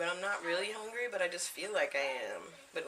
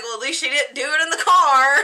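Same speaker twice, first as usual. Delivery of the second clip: much louder, higher, through laughter; laughing quip; 10 semitones higher, 19 dB louder than usual. Retold or imitated. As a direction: crack up while teasing. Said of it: "And I'm like".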